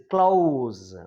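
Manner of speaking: "close" very slow, with a long o-to-u glide; model pronunciation. Shown as a demonstration. Teacher showing a diphthong, "close" slowly stretched. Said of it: "like that".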